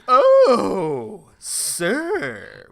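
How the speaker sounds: loud, high, drawn-out mocking "oh"; mock the title with villainous relish; Joker. Hamill mocking Stewart's title in the ad, Joker-style. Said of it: "he went Joker for a little bit".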